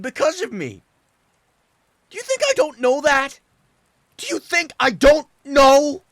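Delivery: tears pouring out through the yelling; sobbing outburst. Acted. Son screaming his guilt at his father, sobbing. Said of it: "sobbing the little of what remained".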